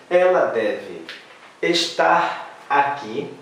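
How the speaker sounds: slow, stretched "estar aqui"; slow take. Shown as a demonstration. Teacher to class, slow version. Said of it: "a gente poderia dizer".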